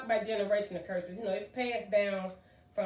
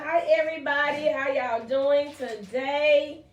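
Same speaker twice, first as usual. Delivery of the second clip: calling out hi, much higher and louder, stretched slow; excited greeting; 6 semitones higher, 7 dB louder, slower than usual. Real, not acted. Podcast host greets live viewers, excited and loud. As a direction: welcome the whole room with big energy.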